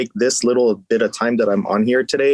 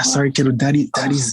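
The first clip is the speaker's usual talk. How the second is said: whispering while hiding; 4 semitones higher than usual. Real, not acted. Hiding in closet, kid interrupts.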